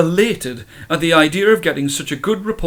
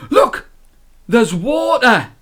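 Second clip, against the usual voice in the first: high, loud excited alarm; 7 semitones higher, 4 dB louder, slower than usual. Acted. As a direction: urgently point out the danger. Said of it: "He excitedly pointed".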